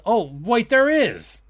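sudden high, bright fake realization; mock surprise. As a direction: act like it just hit you. Mock discovery of stat.

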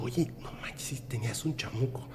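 hushed unvoiced whisper; whisper to compadre. Compadre whispers in bathroom about the demon. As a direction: whisper it so no one hears. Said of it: "Y le dijo".

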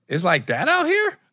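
high, drawn-out disbelief; incredulous question. Retold or imitated. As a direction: retell it as an astonished, rising question. Retold surprised question to promoter.

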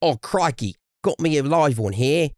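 high, hammed-up Australian voice; crikey, like a wildlife show host; Aussie accent. Co-host tries his own Australian accent on for a laugh. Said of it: "I get the two mixed up".

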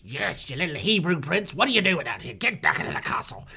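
put-on sneering guard voice; scolding guard. Guard ordering the prince back, mocking scold. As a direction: belittle the prince in character.

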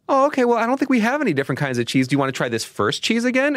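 high, quick sing-song reply; mock gentle dad. Dad to daughter, high quick gentle. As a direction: placate a toddler.

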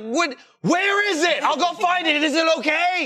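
high, urgent phone plea; frantic questioning. Acted. Customer calling a driver about missing food, high and frantic.

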